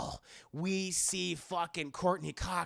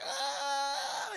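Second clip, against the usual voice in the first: higher mock scream of pain; mock pain cry; 8 semitones higher than usual. Killer hit in the eye, mock pain scream. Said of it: "it's like".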